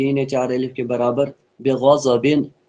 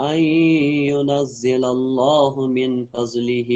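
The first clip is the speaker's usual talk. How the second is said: Quran recitation. Acted. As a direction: draw each syllable out in chant.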